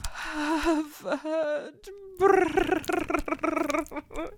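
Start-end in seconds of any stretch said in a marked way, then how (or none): none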